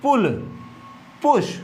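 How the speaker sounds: पुल, पुश with a short "u"; careful पुल, पुश, vowel as in put; short U demo. Shown as a demonstration. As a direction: model पुल, पुश as clipped-vowel separate words. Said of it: "छोटा ऊ का उच्चारण आ रहा है".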